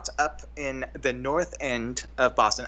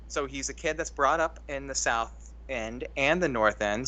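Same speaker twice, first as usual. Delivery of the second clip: normal talking voice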